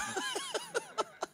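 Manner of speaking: soft high-pitched giggle; high laugh. Real, not acted. Preacher laughing after quoting Pak Marco, high giggle.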